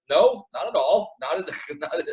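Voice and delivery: high-pitched mock denial breaking into a laugh; acted protest. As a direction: object in playful disbelief. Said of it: "It's like".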